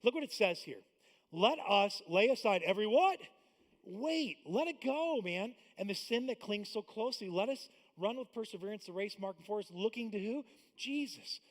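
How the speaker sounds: loud, rising prompts for the crowd to answer; call-and-response preaching. Acted. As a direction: get the congregation to fill in the word.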